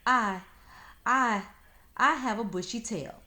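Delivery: loud high stammer; stammering excitedly. Acted. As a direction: stumble over the I. Fox stammering eagerly.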